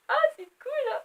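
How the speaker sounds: high, bright-eyed mock delight at the plot; delighted reader. Reader reenacting her joy, high mock delight. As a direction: play the reader loving every page. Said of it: "je vais vous refaire".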